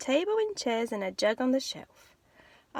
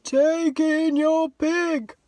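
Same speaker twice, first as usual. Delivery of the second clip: slow, drawn-out sage voice; a very slow, measured wise old man; 4 semitones higher, 6 dB louder, much slower than usual. Acted. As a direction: speak slowly like a calm old sage. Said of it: "said the wise old man".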